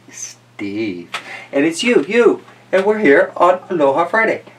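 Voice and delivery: ordinary delivery, nothing marked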